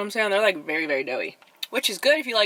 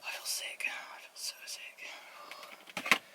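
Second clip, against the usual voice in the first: very soft, slowing whisper; sick whisper; 13 dB quieter, much slower than usual. Real, not acted. Vlogger sick from too many cookies, weak whisper. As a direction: groan it out in a nauseated whisper.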